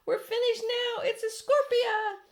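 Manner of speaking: high, loud, slow despairing wail; panicked cry. A narrator's reading. Fire chief wailing in despair at the monster. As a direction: cry out that all is lost. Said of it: "yelled the chief".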